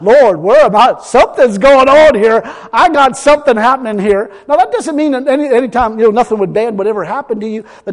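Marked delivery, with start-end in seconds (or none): 0.0-4.3 loud prayer. Acted. Voicing a prayer to God.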